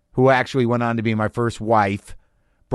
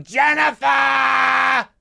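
long, high drawn-out bellow; stretched shout in a thick local accent; calling up from outside; 14 semitones higher, 4 dB louder, much slower than usual. Retold or imitated. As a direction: holler the name up at a window. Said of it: "across from the guy going".

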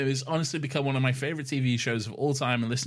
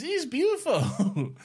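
laughing; slower than usual. Real, not acted. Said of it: "You don't really hold it up with the reverence".

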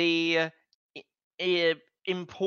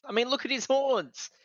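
higher, louder fretful rant; fussy panicky voice; 7 semitones higher, 6 dB louder than usual. Retold or imitated. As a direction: fuss over it in alarm. Acted character line, peak.

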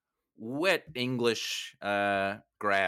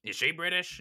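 higher, fast and pushy; mock demanding producer; 8 semitones higher, 3 dB louder than usual. Producer on the phone demanding star actresses, pushy. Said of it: "He's like".

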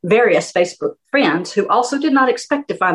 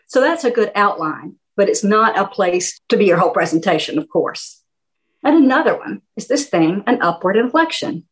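ordinary talk, nothing marked

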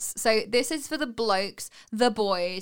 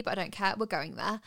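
ordinary delivery

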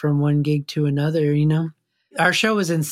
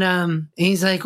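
ordinary delivery, nothing marked

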